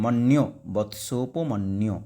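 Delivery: মন্যু then বৎসোপমন্যু said slowly; slow model reading. Shown as a demonstration. Teacher modelling the pronunciation of বৎসোপমন্যু. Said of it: "এইভাবে উচ্চারণ হয়".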